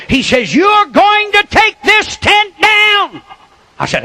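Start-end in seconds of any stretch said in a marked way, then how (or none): 0.5-3.2 slow shouted order, higher; mayor's shout. Retold or imitated. Mayor shouting at the preacher to take the tent down. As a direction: bark the order slowly like an angry mayor.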